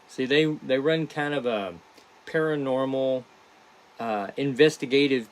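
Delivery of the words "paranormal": ordinary talk, nothing marked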